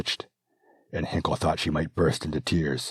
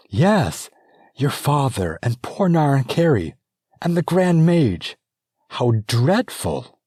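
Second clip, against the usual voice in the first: much higher, louder, aghast lament; dismayed; 11 semitones higher, 8 dB louder than usual. Acted. Princess regent lamenting the dead, high and distraught. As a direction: gasp it out in horrified sorrow. Grieving over the murdered royals.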